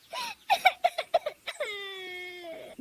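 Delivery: high, wailing mock sob; drawn-out stagey sobbing; crying. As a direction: burst into acted tears. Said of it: "वो रोने लगती है".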